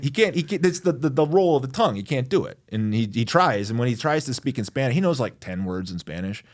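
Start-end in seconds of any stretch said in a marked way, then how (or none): none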